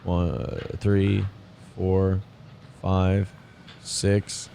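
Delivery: low, hushed count; counting under breath. Real, not acted. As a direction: tick off the seconds very softly. Counting aloud during played clip's pause.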